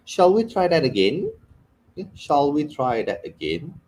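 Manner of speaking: British English accent. Shown as a demonstration. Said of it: "Even the enunciation is different".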